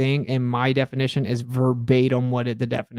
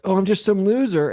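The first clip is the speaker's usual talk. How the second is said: raised, sarcastic self-mockery; 6 semitones higher than usual. Streamer re-enacting own quip taken out of context, sarcastic. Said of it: "like, being sarcastic".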